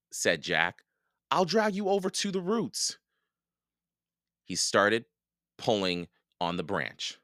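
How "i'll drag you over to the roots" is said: high-pitched, determined rescuer; urgent reassurance. A narrator's reading. Jack pulling Annie from mud, urgent. Rescuer instructs stuck sister.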